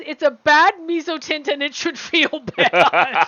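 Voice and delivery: reads it out through laughter; can't hold back a laugh; laughing while reading. Real, not acted. Reading a chat comment aloud.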